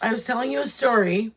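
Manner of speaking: usual speaking voice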